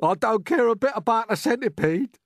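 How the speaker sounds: high, loud blubbering voice; earthworm's hammed-up tearful wail; sobbing. A narrator's reading. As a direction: sob it out mournfully. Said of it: "the earthworm sobbed".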